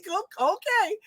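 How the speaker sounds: small, high-pitched okay; meek child reply. Retold or imitated. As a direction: imitate a chastened little child. Child's reply to scolding grandma.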